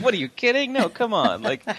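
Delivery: high, laughing disbelief; imitating her laughing reveal. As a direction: laugh it off as a joke. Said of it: "she burst out laughing".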